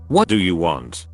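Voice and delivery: ordinary delivery, nothing marked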